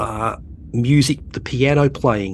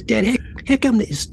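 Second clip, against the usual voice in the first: higher-pitched imitation of a teenage son asking; 12 semitones higher than usual. Son asking his dad during Star Trek, pitched-up teen imitation. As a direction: play the curious teenager, pitched up. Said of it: "He goes".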